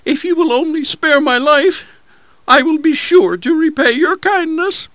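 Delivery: very high, tiny pleading voice; a small mouse pleading with a lion; piteous mouse. Acted. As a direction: beg for mercy like a little mouse. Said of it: "the mouse piteously entreated".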